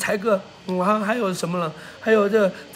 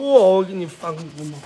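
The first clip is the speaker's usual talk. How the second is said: normal talking voice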